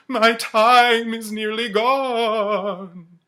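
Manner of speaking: high, loud, very slow warning; urgent ghost. Acted. The ghost warns Scrooge his time ends, slow and high. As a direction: stretch out each word.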